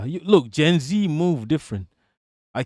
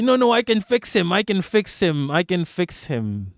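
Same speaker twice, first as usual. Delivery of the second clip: mock-pleading, pitched up then easing off; mock denial; 4 semitones higher than usual. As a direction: play the deluded partner insisting. Acts out abused partner in denial.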